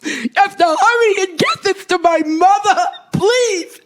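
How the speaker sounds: high, tearful urgent begging; crying plea. Retold or imitated. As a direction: beg through tears, voice cracking high. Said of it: "my son cried and said".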